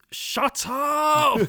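screaming shut up at the top of the voice; shout. Retold or imitated. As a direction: yell it, high and loud. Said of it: "my inner monologue would be like".

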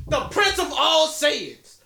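hyped-up title. Acted. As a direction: boom out the title with awe. Hyping Vegeta's title.

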